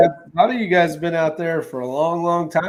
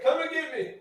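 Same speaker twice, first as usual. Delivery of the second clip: high taunting voice; 12 semitones higher, 10 dB quieter than usual. Pretend. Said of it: "It's like".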